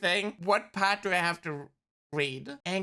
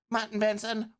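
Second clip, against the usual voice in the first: ordinary delivery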